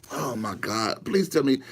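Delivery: usual speaking voice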